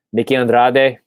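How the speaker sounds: light Spanish-accented name. Real, not acted. Host announcing a cover guest, in a light Spanish accent. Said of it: "I said that with a Spanish accent".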